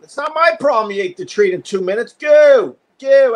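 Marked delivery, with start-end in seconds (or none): 2.1-3.3 loud, high shooing; firm command. Real, not acted. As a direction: shoo the dog off firmly. Shooing the dog away.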